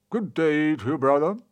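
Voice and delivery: a bright god greeting his brother; good god. A narrator's reading.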